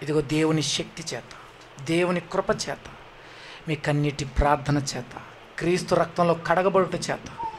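hushed litany. Real, not acted. Solemn litany of salvation.

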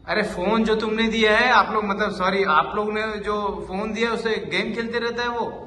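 indignant teacher telling off a parent; exasperated scolding. Acted. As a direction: take the parent to task, voice up. Acted teacher scolds parent about phone.

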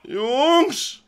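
high call to the guys. Acted. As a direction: hail the boys from afar. Calling out to the police.

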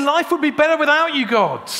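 bitter outburst. Retold or imitated. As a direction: hurl the words at God. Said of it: "He's saying".

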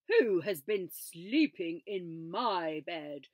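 slow, loud big-bear snarl; papa bear, slow and loud snarl; snarling Father Bear. A narrator's reading. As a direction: snarl it slowly like a huge bear. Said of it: "he snarled".

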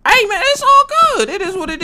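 high falsetto, breezy and resigned; mock-cheerful high voice after dying; falsetto shrug. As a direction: shrug off the death in a squeaky high voice. Shrugs off being killed.

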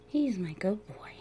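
hushed, breathy whisper to a sleepy pet; soft whisper. Real, not acted. Owner whispers to her drowsy dragon, soft whisper. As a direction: whisper so he drifts off.

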